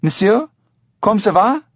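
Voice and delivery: high-pitched call upward; yelled French greeting. A narrator's reading. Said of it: "the heavy-set man yelled".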